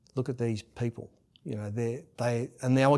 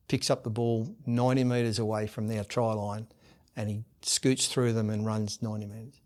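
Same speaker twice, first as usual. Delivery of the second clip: normal talking voice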